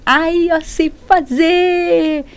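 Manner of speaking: mock-delighted, high and drawn out; overjoyed student. Student who finds the basic rule easy, mock overjoyed. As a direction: sing out a pleased little boast, slowly. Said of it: "É que você fica felizona".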